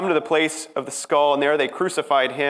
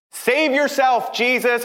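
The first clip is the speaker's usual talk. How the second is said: higher, slow mocking jeer; a taunt thrown at the man on the cross, higher and drawn out; 13 semitones higher, 5 dB louder, slower than usual. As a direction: sneer it up at him. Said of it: "They mock".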